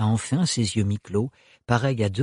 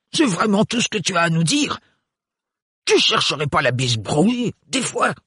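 put-on higher, gruff provoking old man; angry great-uncle; 6 semitones higher than usual. A narrator's reading. The great-uncle taunting Thorne, higher. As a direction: challenge him like an old uncle.

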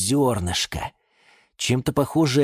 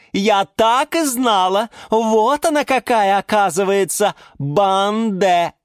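delighted exclaiming; 12 semitones higher, 5 dB louder than usual. Acted. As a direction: savour the discovery out loud. Orchid delighted at her guess. (восторженно, нараспев)

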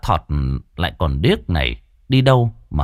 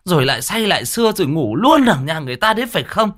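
high, nagging telling-off; acted high-voiced reproach; wifely scolding; 8 semitones higher than usual. A narrator's reading. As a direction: scold the drunk husband, pitched up. Wife scolds husband, grabbing his ear.

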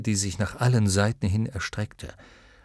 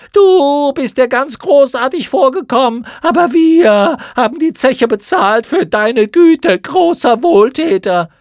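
screaming chorus; 13 semitones higher, 12 dB louder than usual. Acted. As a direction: jeer it back together. Schlamuffen werfen ihm Güte vor. (Mottenchor schreit höhnisch und sehr hoch)

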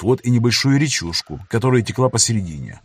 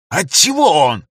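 indignant; 5 semitones higher, 4 dB louder, slower than usual. Acted. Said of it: "начал было снова возмущаться енот".

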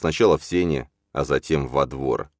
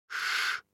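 soft hushing breath; barely audible shush; 10 dB quieter than usual. A narrator's reading. Числав soothing the baby as he unswaddles him, barely audible shush. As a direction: exhale a long, very quiet shh. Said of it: "едва слышно".

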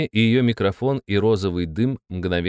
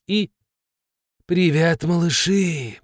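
husky smoker's voice; smoker's rasp; 7 semitones higher, slower than usual. Acted. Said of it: "просипела она хриплым прокуренным голосом".

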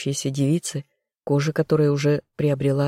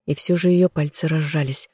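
plain reading voice

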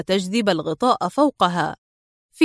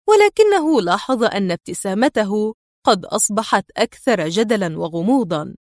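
ordinary delivery, nothing marked

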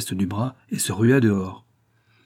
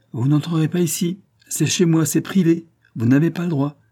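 higher, louder, each phrase separated; words set apart; 7 semitones higher, 5 dB louder than usual. A narrator's reading. Sonia bars the door to the police, words set apart. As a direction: refuse firmly, spacing out the words. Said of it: "dit en détachant les mots".

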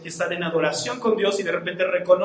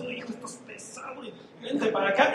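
acted straining; 9 dB quieter than usual. Acts father straining to lift son.

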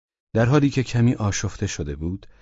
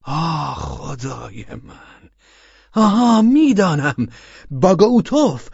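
slow groan turning to a high, loud cry of recognition; sudden realisation; 10 semitones higher, 6 dB louder, slower than usual. Acted. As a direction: let the penny drop and cry out. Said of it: "بالاخره فهمید و فریاد کشید". (فریاد ناگهانی از فهمیدن)

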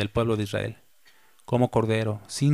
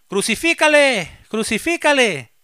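very high, loud cry, the second one slower; crowd shouting; 11 semitones higher, 3 dB louder than usual. A narrator's reading. Crowd cries out to Pilate for crucifixion, high loud shout. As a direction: roar for the crucifixion like a mob. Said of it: "volvieron a dar voces diciendo".

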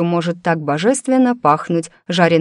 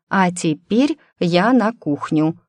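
plain reading voice

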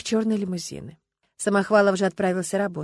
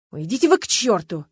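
flaring up; 8 dB louder than usual. A narrator's reading. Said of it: "взвился Варягин".

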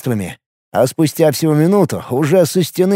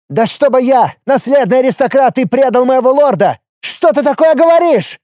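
high shrill squeal of fear; panicked trapped animal; 9 semitones higher, 3 dB louder than usual. A narrator's reading. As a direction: shriek it like a cornered animal. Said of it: "визжал от страха он".

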